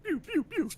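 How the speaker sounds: high imitated game sound effect; cartoon warp-pipe noises; Mario warp noises. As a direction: make high, zippy video-game pews. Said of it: "just Mario Warpoint noises".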